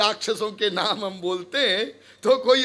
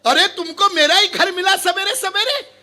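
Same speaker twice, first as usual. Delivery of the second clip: high, outraged householder; indignant scolding; 7 semitones higher than usual. Acted. Householder scolding the early morning visitor, indignant. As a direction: cut him off in high-pitched indignation.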